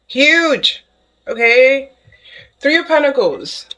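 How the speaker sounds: real excitement bursting through; excited. Real, not acted. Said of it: "Let me calm down".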